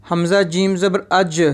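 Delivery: "अज" ending in a bounced j with a brief echo; qalqala bounce. Shown as a demonstration. Teacher spells out a qalqala letter, "अज" with bounced j. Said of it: "इसको हम हलाका पढ़ेंगे".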